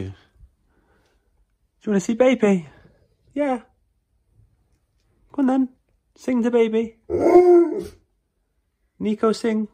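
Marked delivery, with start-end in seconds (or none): 1.8-9.7 high, loud eager call; excited calling. Real, not acted. Urging the dog to greet the baby, loud and eager. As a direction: egg the dog on.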